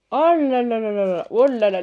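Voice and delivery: loud, excited wonder; real awe. Real, not acted. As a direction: gush over a stunning discovery. Émerveillée en découvrant les cartes. (émerveillement enthousiaste et sonore)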